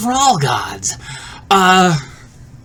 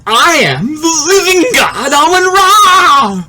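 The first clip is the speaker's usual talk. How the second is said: long drawn-out, high, swelling declaration; booming divine announcement; grand proclamation; 6 semitones higher, slower than usual. Acted. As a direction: proclaim it like a god, stretching every word. Dream proclamation of godhood to friends.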